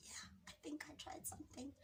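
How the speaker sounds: hushed, unvoiced admission; sheepish whisper. Admitting to her sister she tried something, whispered. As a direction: whisper the confession so no one hears.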